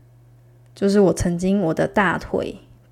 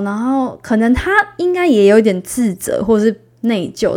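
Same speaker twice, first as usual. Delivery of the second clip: usual speaking voice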